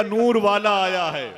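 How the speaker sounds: high, very loud rallying cry, stretched out; slogan shout. Real, not acted. Preacher leads the congregation in a slogan, full-voiced shout. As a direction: belt out the slogan for a crowd. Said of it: "नारा लगेगा".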